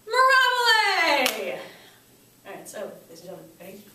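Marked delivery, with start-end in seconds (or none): 0.1-1.6 loud, high, drawn-out cheer; exuberant exclamation. Shown as a demonstration. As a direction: sing it out high and let it fall slowly. Demo of class exclamation.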